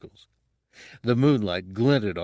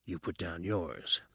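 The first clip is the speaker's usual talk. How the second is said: much softer, barely breathed; whispered; 3 semitones lower, 11 dB quieter than usual. A narrator's reading. Fett answering Han's demand in a whisper. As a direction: whisper it back at him. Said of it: "Fett whispered it".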